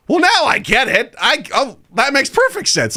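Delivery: high-pitched comic relief; exaggerated "it all makes sense" voice; mock sudden understanding. Dad accepting his child's excuse, mock sudden understanding.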